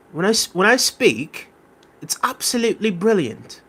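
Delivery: high, lilting British impression; put-on British accent. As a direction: show off your best British accent. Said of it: "you know, British accent".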